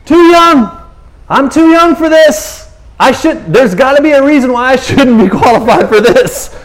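whiny protest. Retold or imitated. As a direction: Jeremiah begging off, half laughing. Jeremiah protesting his calling.

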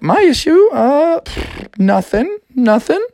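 high, breathy-free lilting princess. Acted. Princess telling Froggy about her sisters, high voice. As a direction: play the upset princess in a high voice. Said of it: "she was like".

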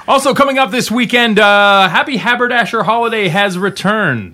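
loud, high radio announcer. Acted. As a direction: hype it like a radio host. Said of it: "I said that like a radio announcer".

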